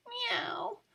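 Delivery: high plaintive cat cry; crying meow. A narrator's reading. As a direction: mew in complaint. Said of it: "cried Wilbur".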